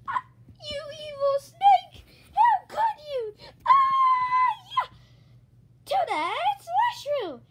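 high, loud shrieking accusation; acted battle cry, shouted high; angry attack. Toadette attacking the evil snake, high furious shout.

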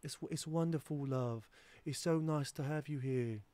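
a light mock British accent, sweet; a lightly put-on sweet British accent; imitating British accent. Mimicking the British girl's sweet British accent. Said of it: "in the British accent".

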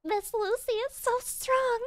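squeaky high mock cheer. Streamer echoing the fans' cheer in a squeaky high voice. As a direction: chirp it like a fan.

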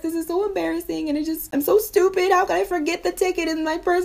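high-pitched tearful wail; hammed-up crying rant, far above normal pitch; mock bawling. Wife bawling to husband about her worst day, mock crying. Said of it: "I start bawling".

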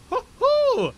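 high, loud "hoo-hoo"; high-pitched hyped whoop; excited whoop. Real, not acted. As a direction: whoop it out with glee. Excited at busy airport.

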